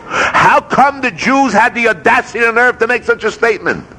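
high-pitched, affronted rhetorical question; indignant challenge. Acted. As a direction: demand an answer, pitched well up. Voicing Torah's rebuke of complainers.

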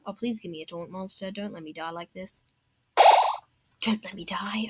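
soft, falling desperate plea; pleading whisper. Real, not acted. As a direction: beg quietly under breath. Begs game for help.

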